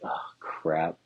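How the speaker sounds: a guilty little whisper; hushed "oh crap" on seeing the live chick; whispered dismay. Retold or imitated. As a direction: whisper it as if caught doing something wrong. Finding a live chick in an egg.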